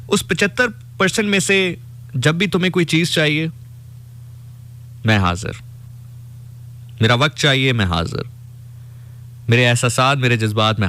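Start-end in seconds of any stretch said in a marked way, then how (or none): none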